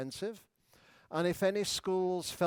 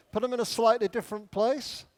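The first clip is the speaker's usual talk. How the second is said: ordinary talk, nothing marked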